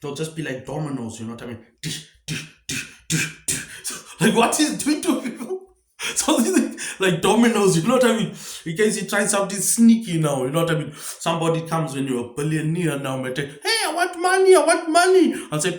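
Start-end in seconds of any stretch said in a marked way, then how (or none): 1.8-3.7 whispery, unpitched "dish" noises in a row; imitated falling dominoes. Rambler showing things falling like dominoes, imitated noise. 4.2-8.3 high, loud talk through real laughter; laughing. Real, not acted. Comic cracking up over his domino idea, laughing. 13.4-15.3 high, loud put-on pestering voice; money-begging caller. Somebody coming to a new billionaire for money, high mock demand.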